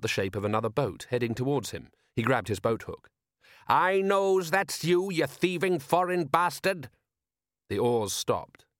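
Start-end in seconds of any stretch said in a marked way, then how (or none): 3.7-6.9 high, drawn-out angry call across water; raised-pitch, accusing fisherman's holler; angry challenge. Acted. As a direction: call out slowly to a hated rival. Challenging a rival boat across water.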